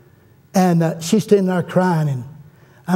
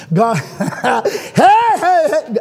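exultant laughing whoop; 7 semitones higher, much slower than usual. Real, not acted. Preacher exulting over his own story, laughing whoop.

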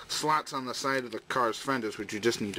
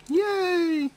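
high, delighted squeal; excited squeal; 6 dB louder than usual. Acted. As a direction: squeal it with glee. Toy clipped into vehicle mode.

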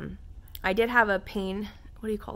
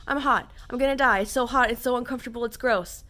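higher, louder frantic whining; exasperated complaining; 5 semitones higher, 5 dB louder, faster than usual. Real, not acted. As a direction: whine about the heat. Venting about overheating.

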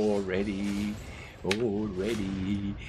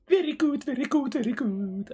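much higher, louder squeaky voice; squeaky mock praise; 4 dB louder, faster than usual. Gamer praising his axe in a squeaky mock voice. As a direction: chirp it like a delighted cartoon. Gleeful about a new axe.

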